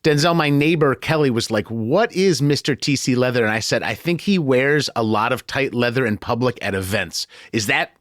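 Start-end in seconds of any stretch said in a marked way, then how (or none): none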